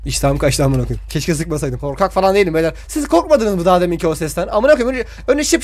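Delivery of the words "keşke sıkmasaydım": ordinary talk, nothing marked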